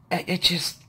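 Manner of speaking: normal talking voice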